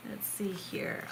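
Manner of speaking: whispered to herself. Real, not acted. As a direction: whisper it under your breath while looking. Muttering while shuffling.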